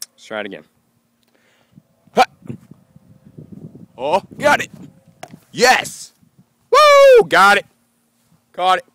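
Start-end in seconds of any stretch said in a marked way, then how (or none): 2.1-8.8 very high, loud triumphant whoops; loud high woo of joy; real cheering. Real, not acted. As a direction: celebrate the catch. Cheering a caught frisbee.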